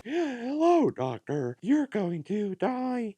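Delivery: slow inhaled robot voice; robot pig voice. Retold or imitated. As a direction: speak while sucking in air. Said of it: "high, sucking in while speaking like a robot voice".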